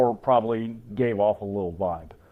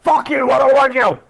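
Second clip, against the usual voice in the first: higher, louder snarly dog; grumpy dog voice; 10 semitones higher, 6 dB louder than usual. Retold or imitated. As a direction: voice the dog who hates everyone. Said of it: "he's like".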